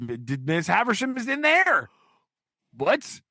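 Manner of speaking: high, rising disbelief; mock shock. Host acts out Pip finding Miss Havisham, mock shock. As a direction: blurt it in hammed-up astonishment.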